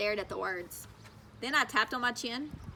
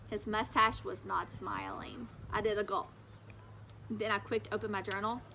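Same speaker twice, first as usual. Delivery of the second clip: ordinary delivery, nothing marked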